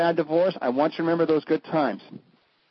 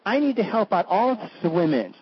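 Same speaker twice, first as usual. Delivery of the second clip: higher, slow, airy socialite voice; mock socialite; 5 semitones higher, slower than usual. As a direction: lampoon a spoiled heiress. Mocking a socialite.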